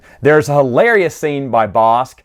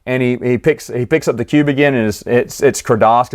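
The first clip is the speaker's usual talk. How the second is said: ordinary delivery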